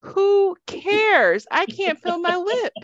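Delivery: loud, high cry about burning lips; frantic pained outburst. Retold or imitated. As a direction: blurt it out in panic. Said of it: "And I'd be like".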